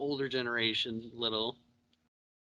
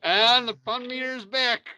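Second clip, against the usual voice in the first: higher, slower excited announcement; bright proclamation, drawn out; announcing; 9 semitones higher, 5 dB louder, slower than usual. Acted. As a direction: call it out like a game show host. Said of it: "announces fun meter".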